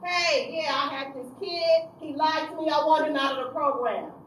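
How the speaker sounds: loud, high, heated; indignant demand. Retold or imitated. Counselor storming into the supervisor's office, loud and heated. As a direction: demand it furiously.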